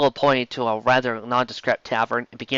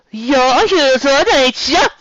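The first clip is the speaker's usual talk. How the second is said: high, loud female Cockney barmaid; Cockney barmaid accent; 13 semitones higher, 7 dB louder, much slower than usual. Acted. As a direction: pitch way up into a brassy Cockney barmaid. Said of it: "Her accent resembled Trondheim".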